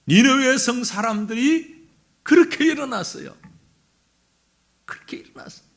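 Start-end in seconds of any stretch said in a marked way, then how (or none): 2.2-3.4 raised, forceful preaching voice; emphatic proclamation. Real, not acted. Sermon climax, then hushed repeat. 4.8-5.6 near-whisper, very soft; hushed repeat. Real, not acted. Hushed repeat after emphatic proclamation.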